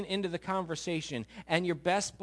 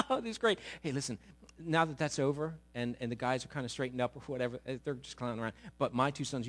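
softer, lower, buttering him up; lightly voiced fawning mom; wheedling mother; 6 semitones lower, 5 dB quieter than usual. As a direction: flatter Jesus before asking a favor. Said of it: "She says to him".

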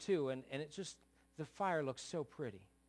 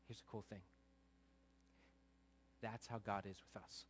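tender; 3 semitones lower than usual. Acted. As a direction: pull the room in close and gentle. Soft tender sermon passage.

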